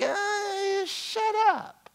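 high, mocking voice of a fed-up spouse; annoyed spouse. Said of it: "it's like".

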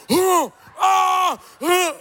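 high, loud imitated shocked noises; mock shocked cries. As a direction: mimic a scandalized congregation's outcry. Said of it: "You guys are like".

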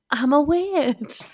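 ordinary talk, nothing marked

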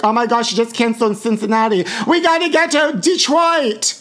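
panicked queen chasing a cancelled show; frantic drag queens. Retold or imitated. Queens panicking over cancelled tour dates, squealing high. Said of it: "all the queens this year are gonna be like".

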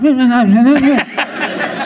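high wordless babble; mock babble. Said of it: "going like".

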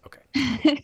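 whispering. Real, not acted. Whispered aside after checking.

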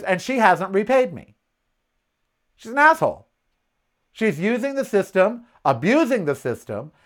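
usual speaking voice

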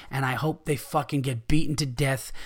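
ordinary talk

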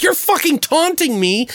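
high-pitched acted indignation; worked-up, accusing comeback; retold angry retort. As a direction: replay your side of the fight, voice pitched high. Retold quarrel, his retort.

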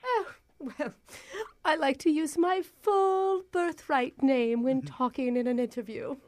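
hammed-up posh English caller; put-on British accent. Prankster posing as job applicant on phone, fake British accent. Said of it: "surprised by your accent".

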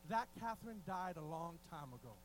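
low, settled, assured reply; imitated unshakeable composure, pitched low; calm confidence. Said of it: "she would say this with confidence".

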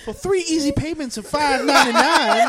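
high, loud TV sales parody; infomercial pitch. Acted. Adding to friends' infomercial bit, high and loud. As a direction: belt out the price like a hammed-up pitchwoman.

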